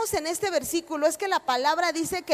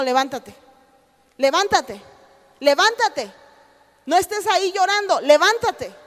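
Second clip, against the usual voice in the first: building from firm to loud commands; each levántate louder, pushing them up; urging to rise; 2 semitones higher, 4 dB louder than usual. Acted. God urging the weeping believer up, building to loud. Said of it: "la voz de Dios siempre está ahí diciendo".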